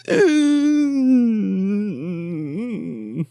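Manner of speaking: loud, high dramatic gasp; exaggerated shocked gasp; mock gasp. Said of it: "And he's like".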